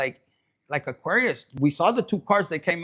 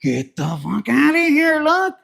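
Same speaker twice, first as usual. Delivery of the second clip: indignant Libra; 3 semitones higher, 6 dB louder than usual. Acted. Libra reacting to the news, loud indignation. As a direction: act out an affronted Libra. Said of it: "there could be a Libra".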